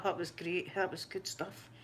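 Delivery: usual speaking voice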